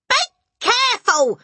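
high, loud, broken warning; alarmed shriek. A narrator's reading. Mother as powder spills, alarmed shriek. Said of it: "shrieked the mother".

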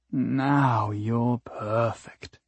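drawn-out, intimate; slow tender line. Acted. Character line, slow, different timbre.

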